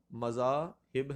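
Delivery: مذاہب slowly as "ma-zaa ... hib"; pronunciation option. Shown as a demonstration. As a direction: say مذاہب split up, ending in "hib". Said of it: "درست لفظ کو کیا ہوگا".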